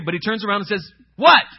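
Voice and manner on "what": loud, high, curt demand; rude. Acted. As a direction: turn round and fire it off rudely. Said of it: "said it in a rude way".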